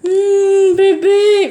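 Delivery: high cooing to the dog. Real, not acted. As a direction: coo sweetly at a pet. Cooing at her pet.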